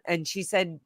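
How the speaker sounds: ordinary talk, nothing marked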